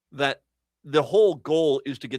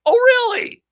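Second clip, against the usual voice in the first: shrieked high "really?" falling hard; a loud, incredulous "Oh, really?"; sarcastic shout of disbelief; mock disbelief; 9 dB louder, slower than usual. Mocking disbelief at DOJ denial.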